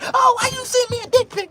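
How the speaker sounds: high, loud put-on woman's voice; mock woman. Woman scolding about the dick pic. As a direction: play the annoyed girl. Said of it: "it ain't gonna be like".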